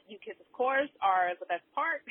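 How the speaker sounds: normal talking voice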